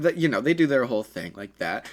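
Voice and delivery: plain reading voice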